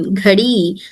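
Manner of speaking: normal talking voice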